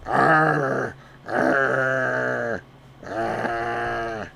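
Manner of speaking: playful growling noise; mock growl. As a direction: growl the sheep along. Growling at sheep.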